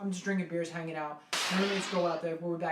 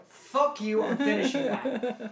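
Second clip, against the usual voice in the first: loud, higher, defiant retort; drunken defiance; 6 semitones higher, 5 dB louder, slower than usual. Retold or imitated. Drunk to roommates pulling the bottle away, loud defiant retort.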